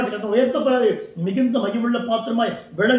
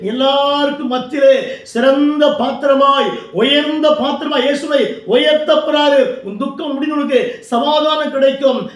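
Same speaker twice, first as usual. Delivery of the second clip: forceful, exhorting sermon voice; fervent preaching; 3 semitones higher, 5 dB louder than usual. Real, not acted. Preacher urging discouraged believers not to fear, fervent preaching. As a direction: preach with urgent, driving conviction.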